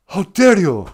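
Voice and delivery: high, loud mock outrage; hammed-up indignant scolding; Greta Thunberg. Scolding a politician over blackface, hammed-up outrage. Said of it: "I don't turn Greta Thunberg on him".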